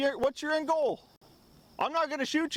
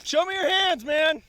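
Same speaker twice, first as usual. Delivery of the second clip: shouted surrender demands; 5 semitones higher than usual. Real, not acted. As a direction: call out the order firmly. Commanding the downed suspect.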